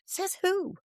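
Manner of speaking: high, challenging self-question; questioning the rule. Shown as a demonstration. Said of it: "ask yourself".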